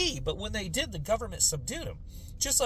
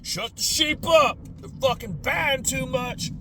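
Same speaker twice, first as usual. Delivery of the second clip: slow, higher sneering mock; scheming politician; 7 semitones higher, slower than usual. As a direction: drag it out like a cynical official. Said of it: "to be".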